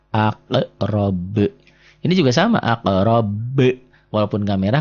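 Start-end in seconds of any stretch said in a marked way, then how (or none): 0.1-1.4 "aqrab" slow, with bounced q and final b; qalqalah. Shown as a demonstration. Teacher showing the red-marked letters, bounced qalqalah. 2.6-3.3 "aqrab" with the final b bounced; qalqalah at stop. Shown as a demonstration. Teacher showing a stop on the word, bounced qalqalah. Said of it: "kalau dihentikan jadi qalqalah".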